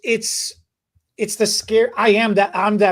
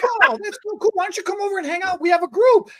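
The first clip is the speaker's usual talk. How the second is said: much higher, bubbly and fast invitation; high energy; 11 semitones higher than usual. Retold or imitated. Outgoing stranger invites shy guest over, bubbly. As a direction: gush like the friendliest person here. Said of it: "they're so coming up energy".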